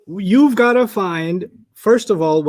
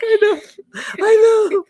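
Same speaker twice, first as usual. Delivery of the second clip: much higher mock sheepish agreement; mock sheepish admission, much higher; imitated sheepish reply; slower than usual. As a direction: squeak it out like a caught kid. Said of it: "I was like".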